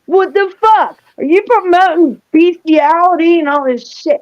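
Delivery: high, indignant retold outburst; flipping out. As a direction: imitate an angry caller demanding answers. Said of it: "he's flipping out on me".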